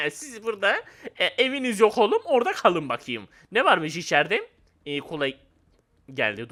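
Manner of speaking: ordinary talk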